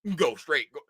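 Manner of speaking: low, soft mimicked directions; traffic-directing official. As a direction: imitate an official waving cars on, quietly.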